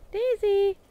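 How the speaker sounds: high, loud call across the yard; ringing shout to a goat. Real, not acted. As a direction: call her name from far off. Calling the goat by name.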